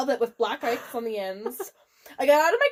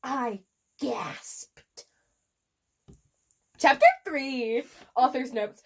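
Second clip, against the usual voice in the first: normal talking voice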